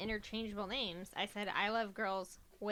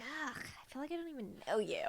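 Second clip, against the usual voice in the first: whisper; 2 semitones higher, 4 dB quieter than usual. Pretend. Mock disgust whisper.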